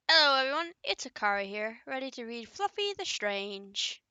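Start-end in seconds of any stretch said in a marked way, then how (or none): none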